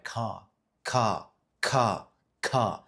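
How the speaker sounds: "ka, ka, ka, ka" as separate repeated syllables, pausing after each; single-word pauses. Shown as a demonstration. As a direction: say "ka" repeatedly, one isolated beat at a time. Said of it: "single word pauses like".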